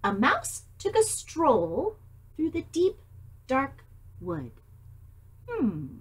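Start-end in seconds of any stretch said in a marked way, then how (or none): 0.0-4.5 put-on reading a picture book to children; gentle read-aloud voice; story narration. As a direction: tell it like storytime for little ones.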